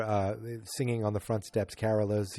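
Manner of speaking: usual speaking voice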